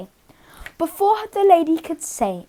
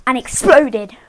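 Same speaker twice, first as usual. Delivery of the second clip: plain reading voice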